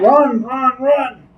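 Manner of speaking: high shouted warning; yelling. Acted. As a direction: shout them away. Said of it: "I'll be yelling".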